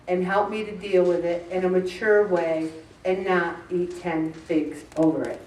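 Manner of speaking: humble trusting prayer. Shown as a demonstration. Modeling a quiet prayer.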